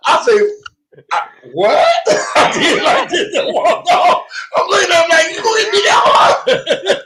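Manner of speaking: high, loud retelling while cracking up; laughing through it. Retold or imitated. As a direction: tell it while laughing hard and shouting.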